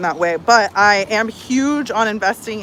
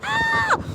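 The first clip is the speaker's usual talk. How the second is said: very high-pitched thrilled squeal; high, drawn-out "ah" of excitement; excited squeal. Pretend. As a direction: squeal with imagined delight. Squeals at the imagined surprise.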